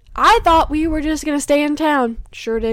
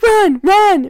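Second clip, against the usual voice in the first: sudden loud high cry of panic; inner alarm yell; 8 semitones higher, 9 dB louder than usual. Retold or imitated. Her gut screaming at her on the date, loud panicked yell. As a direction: yell it like an alarm going off in your head. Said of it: "when my alarm started going off".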